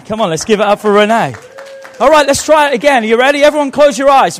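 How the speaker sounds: loud, high, quick call over applause; calling to the crowd. Real, not acted. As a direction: project over a clapping crowd.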